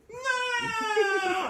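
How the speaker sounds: high, loud wailing no; mock anguished cry. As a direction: howl it like a heartbroken toy Luke. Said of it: "the little Lego Luke goes".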